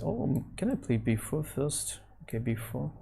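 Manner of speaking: ordinary talk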